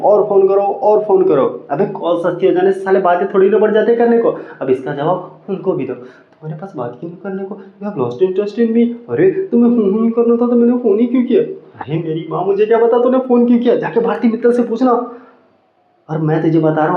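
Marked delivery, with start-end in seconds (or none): 0.0-1.7 raised-pitch parody of an ad pitch; mocked phone ad. Ad urging customers to call more, mock sales push. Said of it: "एक तो इनके ऐड भी देखो". 5.9-11.4 high-pitched, whiny girlfriend imitation; mock complaining girlfriend. Girlfriend accusing boyfriend of losing interest, high mock complaint. 11.8-14.9 high-pitched exasperated retort; exasperated boyfriend. Acted. Boyfriend answering girlfriend about the call, exasperated outburst.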